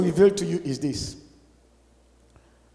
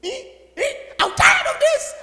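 mock angry complaint; 6 semitones higher than usual. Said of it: "a brother was angry".

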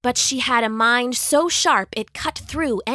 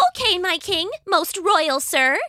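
little mouse; 8 semitones higher than usual. Acted. Mouse answers lion.

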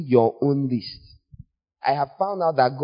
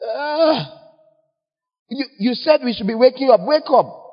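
much higher, indignant mock whine; whiny complaint; 9 semitones higher than usual. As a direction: play the grumpy sleeper protesting. Mocking the woken complainer.

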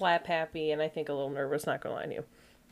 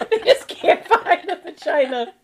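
laughing; 7 semitones higher, 7 dB louder than usual. Real, not acted. Laughing at vagina joke.

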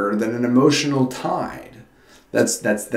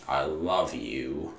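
"I love you" slow, much softer and lower, meaning every word; heartfelt; 9 semitones lower, 11 dB quieter, slower than usual. Shown as a demonstration. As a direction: let "love" linger tenderly, barely above a murmur. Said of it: "There's a big difference between saying".

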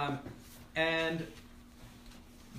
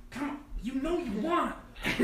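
higher coaxing voice to an object; 10 semitones higher than usual. Acted. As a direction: sweet-talk the carpet. Molly coaxing hovering carpet.

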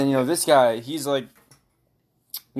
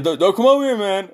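high, loud mock warning; retold alarmed protest; 7 semitones higher, 6 dB louder than usual. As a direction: act out the friend fending you off. Acting out friend warning him off.

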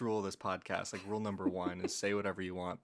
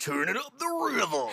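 high, laughing radio DJ call; DJ hype voice; 13 semitones higher, 5 dB louder than usual. Pretend. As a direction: hype the crowd like a DJ. Mock DJ hype line.

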